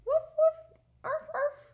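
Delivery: loud high yappy puppy woof; dog sounds. Acted. Teacher voicing Biscuit for the class, loud high bark. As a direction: bark it out like an excited little dog. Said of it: "all of my dog sounds".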